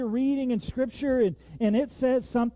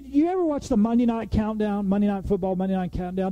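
ordinary talk, nothing marked

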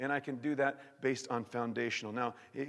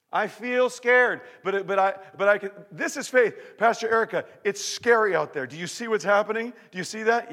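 impassioned preaching; 7 semitones higher, 11 dB louder than usual. Acted. Preacher acts fearful believer loudly.

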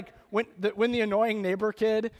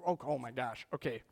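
ordinary talk, nothing marked

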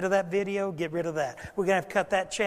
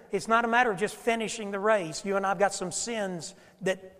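ordinary talk

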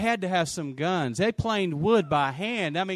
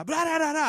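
high nonsense babble cutting in; pitched-up "blah da da" jabber; excited mock chatter; 10 semitones higher, faster than usual. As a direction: imitate an animated reply in gibberish. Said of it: "They're excited".

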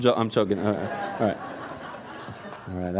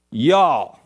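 loud, drawn-out Southern twang; country Southern accent, punched out; 3 semitones lower, 4 dB louder than usual. Shown as a demonstration. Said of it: "that's where the southern accent is".

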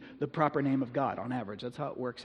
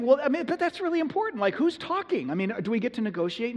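much higher, pleading Moses voice; whiny Moses; 8 semitones higher than usual. Retold or imitated. Moses arguing with God from the bush, high and pleading. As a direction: play Moses haggling, pitched way up.

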